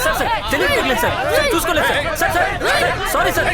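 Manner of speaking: high, loud frantic begging; desperate pleading. Acted. Begging the sir to stop, frantic and loud. Begging the officer during scuffle.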